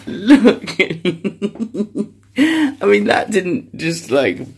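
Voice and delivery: real loud laughter breaking through the words; can't stop laughing at the wrecked umbrella; words bursting out between loud laughs; laughing loudly. Real, not acted.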